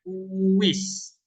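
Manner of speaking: "uwis" said slowly, gliding from u into wis; example word. Shown as a demonstration. Teacher giving an example of the we sound, said slowly. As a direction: draw out "uwis" with a clear w glide. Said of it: "Tapi jadinya we".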